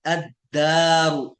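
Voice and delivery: अद्दारु very slow, dal doubled, no lam; Arabic sun letter demo. Shown as a demonstration. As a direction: stretch out अद्दारु, skip the l. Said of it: "लाम नहीं पढ़ा जा रहा है".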